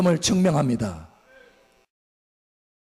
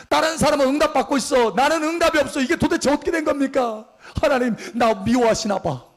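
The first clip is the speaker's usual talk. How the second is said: hurt complaint; 7 semitones higher than usual. Retold or imitated. Voicing jealous unanswered believer.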